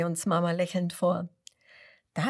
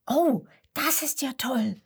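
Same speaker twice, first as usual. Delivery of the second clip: hoher, begeisterter Kinderausruf; 5 semitones higher than usual. A narrator's reading. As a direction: staunend und begeistert rufen. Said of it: "ruft Leon begeistert". (Leon ruft begeistert beim Blick in die Kiste)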